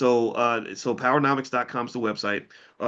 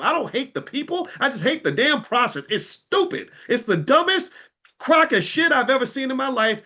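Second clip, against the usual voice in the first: much higher, heated rant; real anger; 11 semitones higher, 3 dB louder than usual. Real, not acted. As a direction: vent it, rising to a loud peak. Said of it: "I'm getting angry".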